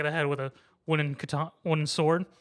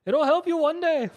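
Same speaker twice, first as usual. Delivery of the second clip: high, loud put-on grandfather; high-pitched, raised old-relative voice; grandfather in flashback; 12 semitones higher, 5 dB louder than usual. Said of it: "flashbacks of stuff of his grandfather".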